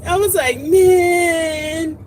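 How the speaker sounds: high, laughing through tears; laughing almost to tears; laugh-crying. Real, not acted. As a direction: crack up while retelling the sulk. Laughing-crying while retelling.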